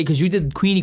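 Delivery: ordinary delivery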